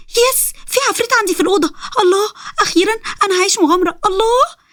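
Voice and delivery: put-on very high, thrilled girlish squeal; overjoyed. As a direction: celebrate an adventure in a soaring voice. Thrilled to find a demon.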